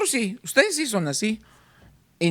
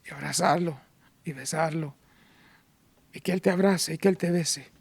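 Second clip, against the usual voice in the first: hushed, lower sermon voice; quiet heartfelt appeal; soft tender preaching; 3 semitones lower, 4 dB quieter than usual. Acted. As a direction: draw them close gently. Soft intimate sermon passage.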